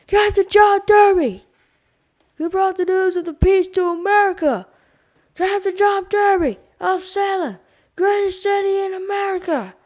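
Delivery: loud, high cheering shout; yelled. A narrator's reading. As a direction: cheer it over the noise. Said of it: "someone yelled".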